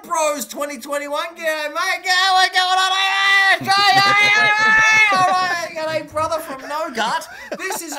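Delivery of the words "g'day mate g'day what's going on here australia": loud Aussie greeting. Acted. Greeting Aussie chat loudly.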